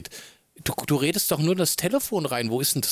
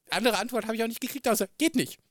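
exasperated; 10 semitones higher, faster than usual. Real, not acted. Father's exasperated punchline.

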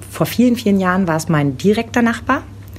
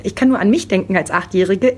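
usual speaking voice

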